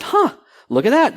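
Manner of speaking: mild retold surprise; surprised. Finding the hotel's order on the site, surprised. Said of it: "I said".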